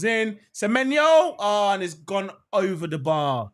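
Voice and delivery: building to a loud, high peak, then easing; excited chance call. Real, not acted. Host calling Bournemouth's chance over the bar, excited build and release. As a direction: build fast to a shout on the striker's name.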